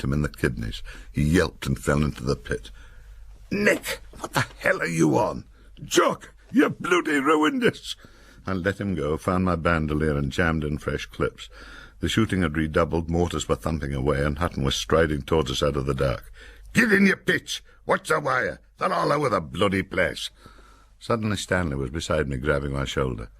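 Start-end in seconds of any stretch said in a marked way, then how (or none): 3.5-7.9 much higher, slow indignant shout; outraged yell. A narrator's reading. Nick yelling after being struck. 16.7-20.3 barked order. A narrator's reading. Hutton striding up, giving orders.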